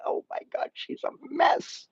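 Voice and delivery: breathy whispered disbelief; whispered aside. Retold or imitated. Viewer whispering about the crying heroine. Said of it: "I'm like".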